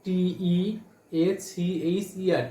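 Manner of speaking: টি ই এ সি এইচ ই আর, each letter slow and separate; letters of টিচার spelled out slowly for the class; spelling out teacher. Shown as a demonstration. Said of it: "তোমরা টিচার উচ্চারণ করো, দেখো".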